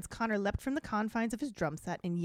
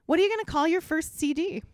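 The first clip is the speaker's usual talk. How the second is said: eager question; 8 semitones higher, 6 dB louder than usual. A narrator's reading.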